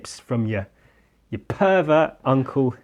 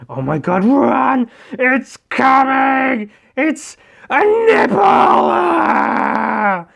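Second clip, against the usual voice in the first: much higher, louder mock panic; panicked screaming; 12 semitones higher, 8 dB louder, much slower than usual. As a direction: scream like fleeing a monster. Citizens fleeing giant nipple.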